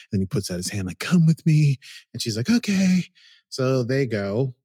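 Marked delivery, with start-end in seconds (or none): 1.0-1.8 louder, higher coaxing call; hammed-up beckon; mock beckoning. Narek reaching out his hand to Soji, loud mock coaxing. 2.5-3.0 high, loud eager okay; a hammed-up willing yes; mock eager okay. Soji agreeing to follow Narek, high eager mock.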